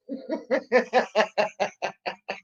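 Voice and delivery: short quiet laugh; amused laugh. Real, not acted. Streamer laughing at a troll's saying, a quiet chuckle.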